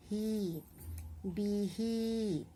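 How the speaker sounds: "he, be he" with drawn-out ee sounds; long vowels. Shown as a demonstration. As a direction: hold each vowel long in "he, be he". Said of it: "read in a little long accent".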